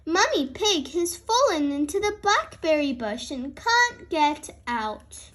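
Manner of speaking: usual speaking voice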